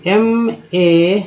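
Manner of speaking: slow letter by letter; spelling out letters. Shown as a demonstration. Teacher spells made slowly for students. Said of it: "क्या उच्चारण करते हैं".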